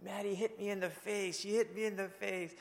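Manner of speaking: put-on high, whiny child voice, as if crying; high tearful kid complaining; bawling little girl. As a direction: mimic a hurt little girl tattling through tears. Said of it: "she just started bawling and bawling".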